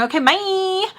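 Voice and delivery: high sing-song sign-off; exaggerated high phone farewell; millennial phone bye. Shown as a demonstration. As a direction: lift "bye" high and hold it. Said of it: "all the time while I'm on the phone".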